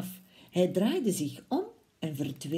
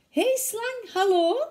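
much higher, brightly called hello; cheery mouse greeting; 12 semitones higher, 5 dB louder than usual. Acted. Mouse greets Snake in front of the Gruffalo, high and cheery. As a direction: sing out a hello to a friend. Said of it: "zei de muis".

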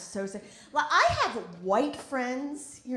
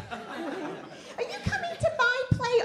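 put-on slow, higher whining kid voice; whiny child; 13 semitones higher, slower than usual. Comic voicing her child begging at her. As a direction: beg like a little kid.

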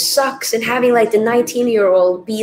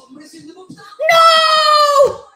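much higher, louder cry of despair; anguished shout; 14 semitones higher, 6 dB louder than usual. Real, not acted. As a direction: yell it as the hope dies. The decisive penalty is missed.

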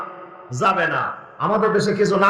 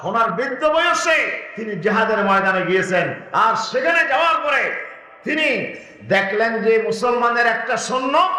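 ordinary delivery

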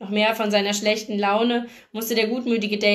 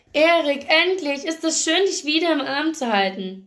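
joyful greeting; 6 semitones higher than usual. A narrator's reading. Sister greets long-missed brother.

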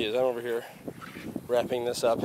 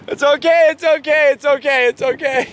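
louder soothing repeats that fade off; reassuring; 3 semitones higher, 6 dB louder than usual. Real, not acted. Comforting a crying child.

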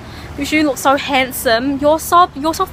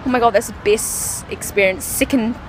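exhausted sigh; 8 semitones lower, 7 dB quieter than usual. Real, not acted. Exhausted fan winding down.

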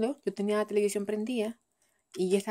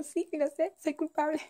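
put-on baby voice; 8 semitones higher than usual. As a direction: play the guilty baby, giggling. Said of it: "y se ríe como".

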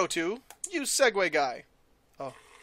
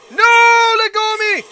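much higher, louder hammed-up scream; mock panic scream; 11 semitones higher, 12 dB louder than usual. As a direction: shriek like being dragged off. Character grabbed, screams.

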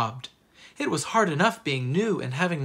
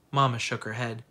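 ordinary delivery

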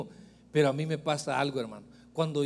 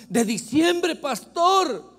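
higher, louder worried woman; anxious sister; 10 semitones higher, 6 dB louder, much slower than usual. Retold or imitated. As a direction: plead it like the fretting church sister. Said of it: "me dijo una hermana".

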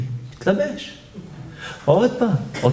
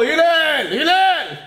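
high, drawn-out calling yell; mock shout; 9 semitones higher, much slower than usual. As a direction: call the name from afar. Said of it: "צועק לו". (צעקת קריאה גבוהה ומתמשכת)

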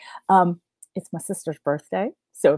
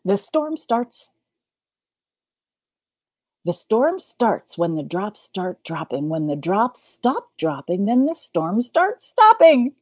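tongue twister reading; 3 semitones higher, 4 dB louder than usual. A narrator's reading. Storyteller tackling the last tongue twister, building to triumph. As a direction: take it deliberately, then punch the ending.

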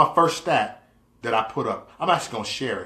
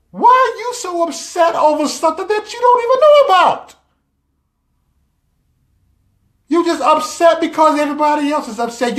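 high, loud acted tirade; heated scolding; 13 semitones higher, 6 dB louder than usual. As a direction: lay into them, pitch climbing toward a shriek. Acted scolding of upset voters.

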